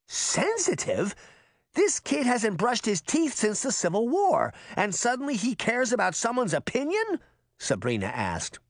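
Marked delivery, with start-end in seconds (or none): 0.1-7.2 high, indignant questioning; incredulous scoff. A narrator's reading. Girl disputes granny calling the boy sensitive, incredulous. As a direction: stretch the first word, then rant in disbelief.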